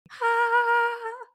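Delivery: high fangirl "ah"; delighted squeal. Real, not acted. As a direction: let out a high, gleeful squeal. Fan squeals at favorite line.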